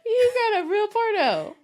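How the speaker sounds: shocked, high laughing words; laughing in disbelief. Real, not acted. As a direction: crack up in disbelief.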